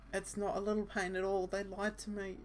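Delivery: put-on high, pained girl's voice, slowed on hurts. Asa Senpai telling him it hurts, high and pained. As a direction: whimper it out like a frightened young woman.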